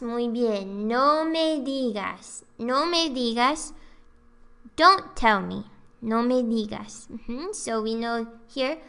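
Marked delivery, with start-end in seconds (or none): none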